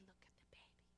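soft, breathy hushed whisper. Retold or imitated. Husband whispering to wife beside sleeping baby, hushed whisper. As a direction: hush so you don't wake her.

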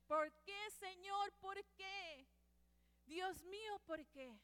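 high, loud anguished cry in Spanish; crying plea. Retold or imitated. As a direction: wail it like a grieving woman. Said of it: "she started crying".

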